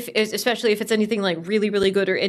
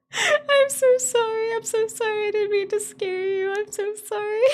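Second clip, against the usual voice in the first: much higher tearful sorry; tearful apology; 11 semitones higher than usual. Real, not acted. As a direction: sob out a sorry. Crying apology after scream.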